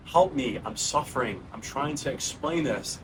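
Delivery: ordinary talk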